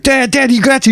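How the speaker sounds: put-on high-pitched child calling for Dad; excited kid. Child running in to Dad, high excited kid voice. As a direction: pipe up like an eager little kid. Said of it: "running in to see my dad".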